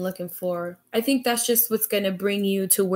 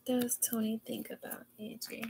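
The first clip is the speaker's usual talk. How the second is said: whispering; 14 dB quieter than usual. Real, not acted. Whispered question to the cards.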